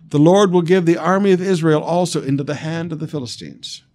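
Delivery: loud, forceful scripture proclamation; prophetic pronouncement. A narrator's reading. Prophet declaring Israel's defeat to Saul, forceful pronouncement. Prophet's judgment on the army, read aloud.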